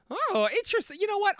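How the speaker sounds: high, perky delight; bright excited. Retold or imitated. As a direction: sound intrigued and chirpy. Mock self in mall.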